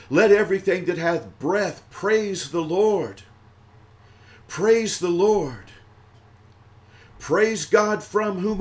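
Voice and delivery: ringing, drawn-out doxology; preaching praise. Acted. Preacher leading worshippers in praise, declaimed doxology. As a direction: declaim each line of praise with long endings.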